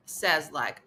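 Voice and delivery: normal talking voice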